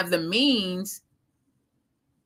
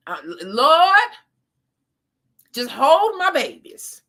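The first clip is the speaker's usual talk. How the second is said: pleading prayer; 6 semitones higher, 5 dB louder, slower than usual. Retold or imitated. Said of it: "But I'll be like".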